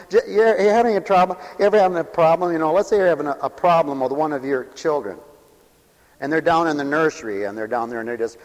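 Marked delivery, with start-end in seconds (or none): none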